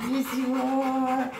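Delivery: high, soft sing-song call to a pet; coaxing the dog. Real, not acted. Praising her dog.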